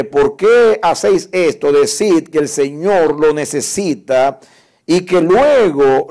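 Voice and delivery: loud, high-pitched declaiming of a Bible passage; forceful scripture reading. Acted. Preacher reading Marcos once to the congregation, loud proclamation.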